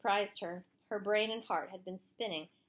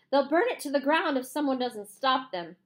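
urgent; 6 semitones higher, 7 dB louder than usual. A narrator's reading. Pleading urgently with sheriff.

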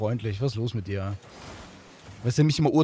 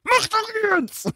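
stretched, pitched-up urging; impatient high shout; much slower than usual. Real, not acted. Gamer to slow teammate: high, drawn-out urging shout. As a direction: hurry him up with a high drawn-out yell.

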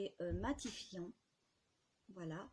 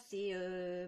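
ordinary delivery

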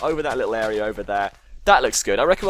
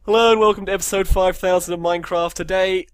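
hammed-up host intro, higher and louder; Dead Wombat TV voice; 7 semitones higher, 7 dB louder than usual. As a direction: greet the viewers like a big channel presenter. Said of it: "I always do my Dead Wombat T V voice for this".

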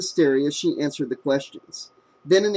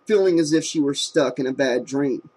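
usual speaking voice

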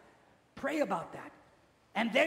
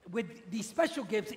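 ordinary delivery

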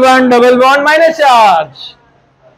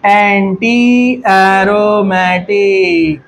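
very slow, every syllable stretched; drawn-out dictation; 2 semitones lower, much slower than usual. Real, not acted. Dictates term slowly while writing.

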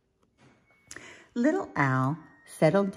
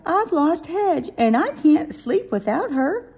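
crying out; 14 semitones higher, 8 dB louder than usual. A narrator's reading. Little Owl cannot sleep without Hedge, cries to Mommy. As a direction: wail the loss as a distressed little owl. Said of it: "he cried".

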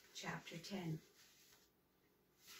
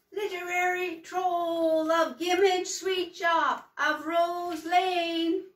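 high, slow, loud announcing of the title; grand storybook title; dramatic title read; 9 semitones higher, 11 dB louder, slower than usual. A narrator's reading. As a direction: boom the chapter title out slowly like a showman.

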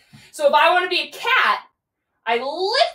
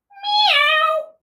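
high playful cat meow. Pretend. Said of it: "lift your back up and say".

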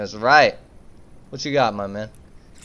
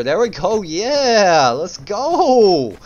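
higher, swooping celebration; excited cheer; 4 semitones higher, much slower than usual. Real, not acted. Player celebrating a game of catch, excited cheer. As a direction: cheer it on, stretching the words.

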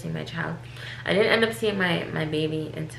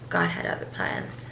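soft whisper, much lower; 13 semitones lower, 5 dB quieter than usual. Real, not acted. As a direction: breathe it out as a quiet confession. Solemn aside about birth.

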